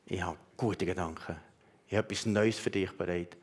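soft voice of God. Acted. God's words voiced softly.

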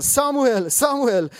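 loud, high falling call. A narrator's reading. The Lord calls Samuel a third time, calling out loudly. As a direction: call the name out twice with force. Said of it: "rief der Herr zum dritten Mal".

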